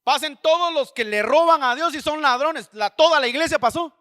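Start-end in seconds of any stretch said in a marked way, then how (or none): 0.0-2.7 raised voice summoning a crowd; loud summons. Retold or imitated. Preacher calls the thieves forward in church, loud summons. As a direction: call out high and loud to the whole church. Retold calling out to congregation.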